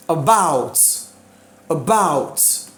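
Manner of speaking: About with BOUT punched and drawn out, pitch falling; teacher's model stress on About; stress on second syllable. Shown as a demonstration. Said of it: "exemptions preposition".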